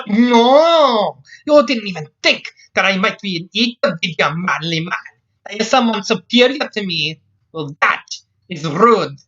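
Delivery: long loud "no", then high-pitched rant; offended Scots scolding. Acted. Scotswoman feeling belittled by Jack, indignant scolding. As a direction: tell him off in a high Scots accent.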